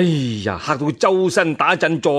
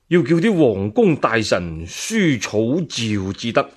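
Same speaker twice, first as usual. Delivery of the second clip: scheming minister; 5 semitones lower than usual. Acted. Voices minister Yuwen Huaji. (低沉陰謀大臣)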